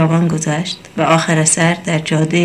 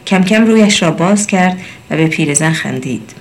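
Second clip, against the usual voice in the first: usual speaking voice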